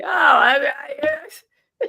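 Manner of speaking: high squealing laugh through words; laughing hard. Real, not acted. Laughing as she recalls the trial. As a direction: crack up while talking.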